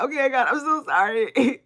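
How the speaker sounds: a pleading little sorry, half joking; mock apology to God. Apologizing to God after cussing him out, sheepish and pleading. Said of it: "But sometimes I'm like".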